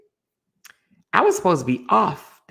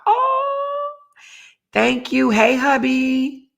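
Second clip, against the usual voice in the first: delighted; 8 semitones higher, 5 dB louder, much slower than usual. Real, not acted. Thanking viewer and her husband.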